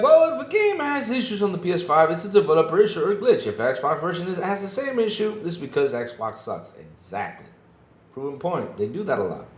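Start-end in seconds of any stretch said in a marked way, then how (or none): 0.0-6.7 mocking quote. Mocking biased console reviewers.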